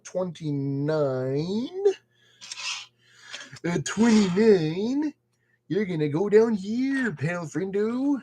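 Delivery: slow, drawn-out goofy voice; silly put-on voice. Chatting to puzzle piece twenty-nine as a pal, silly voice. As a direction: stretch the words out in a daft voice. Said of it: "I don't know I'm talking in that voice".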